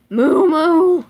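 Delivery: loud, high mock moo; bad cow impression. Child reading a picture book, doing a loud cow moo. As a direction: moo like a cow, badly on purpose. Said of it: "I really, really hate my cow one".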